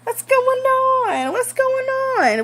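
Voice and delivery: high, slow sing-song innocence; fake innocent girl. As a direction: play dumb in a high sweet voice. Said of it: "she's coming out like".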